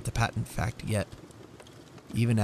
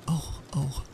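high, startled cry; little cry; 5 semitones higher than usual. Acted. Wife jumping up, crying out high. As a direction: yelp like a singed cat. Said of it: "leaped up like a little singed cat and cried".

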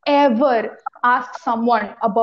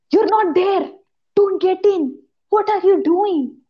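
high-pitched, indignant mock telling-off; mock scolding; 5 semitones higher than usual. Host retelling rebuke to nosy friend, mock indignant. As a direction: tell off an intruder, pitched high.

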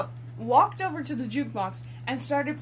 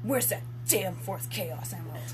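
a hint of a low brooding hero; low, understated edgy hero line; lightly voiced Shadow; 5 semitones lower, 4 dB quieter than usual. Retelling the game's line, lightly brooding. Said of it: "he straight up just goes".

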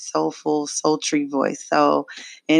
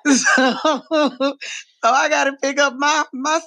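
laughing; 9 semitones higher than usual. Real, not acted. Laughing about it hurting.

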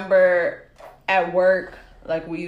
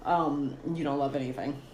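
soft, low, unconvinced; quiet disbelieving aside; skeptical murmur; 6 semitones lower, 9 dB quieter than usual. Retold or imitated. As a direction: mutter it doubting him. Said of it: "I didn't believe it".

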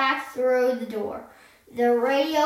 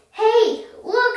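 high, louder exclamation; calling attention; 7 semitones higher, 5 dB louder than usual. A narrator's reading. Annie alerts Jack to the tilting floor, urgent callout. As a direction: pitch it up to get his attention.

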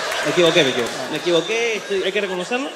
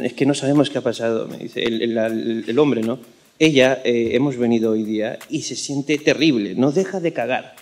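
put-on Spanish accent; 9 semitones lower than usual. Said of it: "tu imitación de español".

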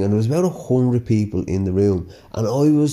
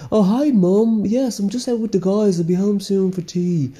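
higher, sweet-as-pie voice to his mum; innocent son on the phone; 9 semitones higher than usual. Retold or imitated. Lad answering his mum's call mid-night out, mock innocent. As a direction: play the good boy for mum, all innocence. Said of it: "You're like".

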